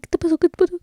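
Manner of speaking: high, loud, frantic mother's question; alarmed mom. Retold or imitated. Mother begging her crying daughter to explain, high and alarmed. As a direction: mimic a panicked mom demanding answers.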